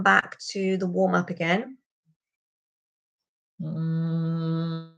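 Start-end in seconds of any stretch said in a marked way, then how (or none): 3.6-4.9 resonant hum. Shown as a demonstration. Teacher leads a hum to the back of the mouth. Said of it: "resonate in these cavities".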